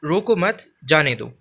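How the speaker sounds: "रोको मत" as one unit, then falling "जाने दो"; pause after मत. Shown as a demonstration. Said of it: "तीसरा वाक्य पढ़ेंगे".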